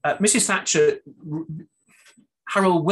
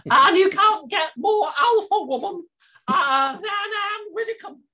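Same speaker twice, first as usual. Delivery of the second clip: Widdecombe voice; 6 dB louder, slower than usual. Retold or imitated. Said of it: "I do Maggie Smith".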